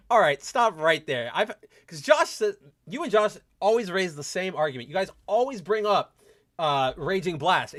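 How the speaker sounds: ordinary talk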